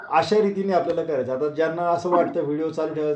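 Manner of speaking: ordinary talk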